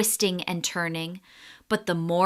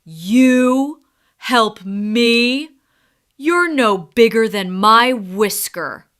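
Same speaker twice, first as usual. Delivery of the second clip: amused lion; 2 semitones higher, 7 dB louder, slower than usual. Acted. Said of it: "Leo burst out laughing".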